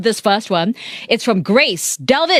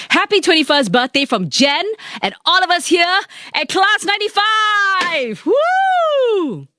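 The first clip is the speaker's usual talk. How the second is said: much higher, shouted birthday wish; over-the-top birthday shout; 10 semitones higher than usual. Real, not acted. Wishing Gwyneth happy birthday on air, an over-the-top shout. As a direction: belt it out for Gwyneth. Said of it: "I think it went a bit too far".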